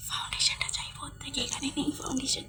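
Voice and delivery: whispered aside; hushed, breathy whisper. Real, not acted. Aside while calling brother.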